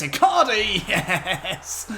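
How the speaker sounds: high, loud cry of joy with a laugh; excited goal shout. Real, not acted. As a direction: yell the scorer's name in triumph. Last-minute goal before half-time.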